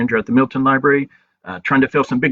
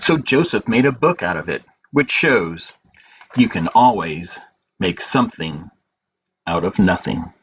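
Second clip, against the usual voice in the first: story narration; 3 semitones lower than usual. A narrator's reading. Librarian reading a picture book to children, gentle storytelling.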